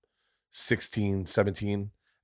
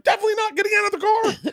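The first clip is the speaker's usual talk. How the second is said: high, fast frightened squeal; locked in the car, squeaking no; panicked refusal; 4 dB louder, faster than usual. Retold or imitated. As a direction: refuse in a rush, terrified.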